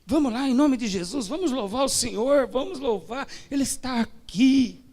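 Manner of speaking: rally the church to praise; pastor urging praise. Retold or imitated. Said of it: "Ele falou".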